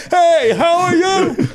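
high, drawn-out strained holler; yelling over a stampede. Acted. Actor greeting partner as cattle stampede past, yelling. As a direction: call it out slowly over thundering cattle. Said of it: "two hundred cattle running down the street".

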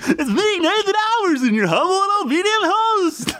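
extremely high, silly host caricature; hammed-up falsetto podcast host; mock Nathan impression. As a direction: introduce yourself in a squeaky, over-the-top voice. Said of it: "my impression of Jake and Menzel's impression of me".

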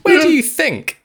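high, loud mock yell at a character. Ranting at the film hero, loud mock yell. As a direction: shout it at the screen in mock outrage.

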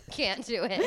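normal talking voice

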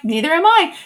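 high, light neither am I; lightly voiced Mary, amused; playful Mother Mary. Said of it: "So she was like".

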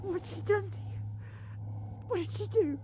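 grieving over a pet. Acted. Finds her pet harmed.

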